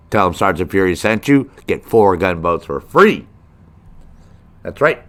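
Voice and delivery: loud hard-sell car-lot ad voice; mock commercial hawking free gunboats; infomercial pitch. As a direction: sell it like a pushy TV salesman. Fake boat sales pitch.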